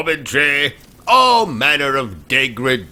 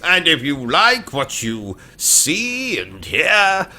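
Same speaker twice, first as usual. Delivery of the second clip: put-on British accent; 3 semitones higher than usual.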